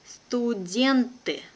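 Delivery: slow and careful, drawn out for learners; teacher's careful slow reading. Shown as a demonstration. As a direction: spell it out slowly for the class. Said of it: "one first time will be slow".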